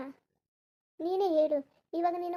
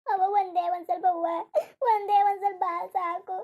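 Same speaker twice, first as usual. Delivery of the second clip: crying plea; 4 dB louder than usual. Acted. Daughter begs her dead mother for milk, crying.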